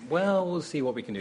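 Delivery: high-starting, easygoing non-promise; noncommittal Hollywood brush-off. Retold or imitated. Director politely putting off a keen actor, noncommittal. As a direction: fob someone off Hollywood-style. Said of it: "that kind of Hollywood thing".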